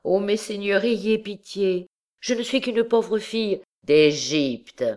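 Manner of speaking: a touch of a desperate plea; pleading. A narrator's reading. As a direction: beg the lords for mercy. Accused begs judges for pity.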